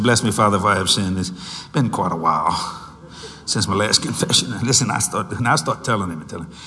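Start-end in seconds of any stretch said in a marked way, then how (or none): 0.0-4.5 low, lightly voiced confession; teenage boy confesses to the priest in a low voice; young penitent. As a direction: mumble it low as a nervous teen. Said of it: "I said".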